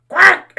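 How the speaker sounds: very high, very loud mock quack; loud duck quack. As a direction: blast a duck call through the woods. Imitating the duck call again.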